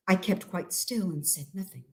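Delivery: hiding motionless, speaking hushed. Acted. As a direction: keep it almost silent. Holding still in the dark.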